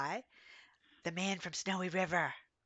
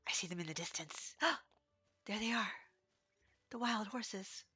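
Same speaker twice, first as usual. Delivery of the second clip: hushed whisper on spotting them; awed whisper; 2 semitones higher, 8 dB quieter than usual. Real, not acted. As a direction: whisper so the horses don't bolt. Spotting wild horses, hushed.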